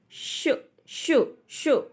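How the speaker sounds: शु said with lips nearly shut, barely voiced; शु, शु, शु breathed out slowly with no open vowel; closed-mouth demo. Shown as a demonstration. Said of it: "मतलब मुंह ही नहीं खुलेगा". (मुंह बंद रखकर शु, शु धीरे से बोलना)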